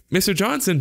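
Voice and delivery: high-pitched call, no real volume; alarmed cry, held back; mild mock shout. A narrator's reading. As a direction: call out in a worried boy's voice. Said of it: "Jeremy shouted".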